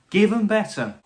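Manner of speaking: excited. Real, not acted. Demanding better Sea Devils.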